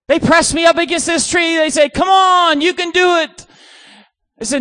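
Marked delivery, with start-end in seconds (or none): 1.9-3.5 loud, high imitation of pushy campers; mock peer pressure. Campers urging the preacher up the tree, mock pushy shout. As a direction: egg him on at a raised pitch.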